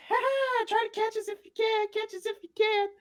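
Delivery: cheeky little creatures daring them to chase; tiny creature taunt. Acted. Squeak Squad taunting the fallen players, squeaky and high.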